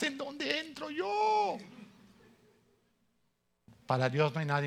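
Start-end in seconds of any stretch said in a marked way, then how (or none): 0.0-1.6 whiny plea. Retold or imitated. Hopeless plea to lawyer.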